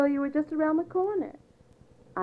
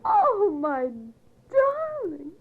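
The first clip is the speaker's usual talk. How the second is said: put-on girlish squeal; 8 semitones higher, much slower than usual. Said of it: "your girlish squeals".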